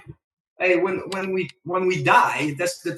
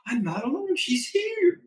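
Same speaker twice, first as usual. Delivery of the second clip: delighted; 10 semitones higher than usual. Retold or imitated.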